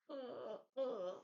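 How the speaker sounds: high strained uh uh, almost crying; high pitched pained stutter; stammering groan. Retold or imitated. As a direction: mimic a choked, whimpering stammer. Mock Ghost Rider stammer.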